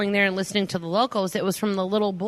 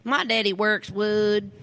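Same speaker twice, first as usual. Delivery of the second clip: put-on drawled Alabama accent; slower than usual. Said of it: "everything was elongated".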